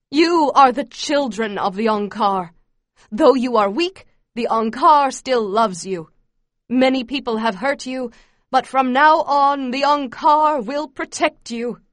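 loud, emphatic indoctrination speech; fervent yell. A narrator's reading. Camp leader promising children protection, yelling fervently. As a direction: declare it forcefully, building to the promise. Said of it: "Mebong yells out".

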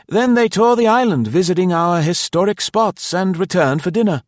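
high, loud, chirpy innkeeper; pleasant host. A narrator's reading. Host explains the house to arriving guest, pleasantly. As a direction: rattle on pleasantly, pitched well up.

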